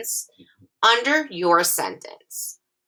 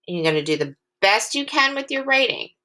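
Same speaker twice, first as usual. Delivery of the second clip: normal talking voice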